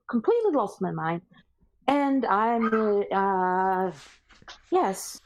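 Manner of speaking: a lightly voiced nervous Josiah; Josiah gone mad. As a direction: play him resigned to madness. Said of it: "trying to get his accent down right".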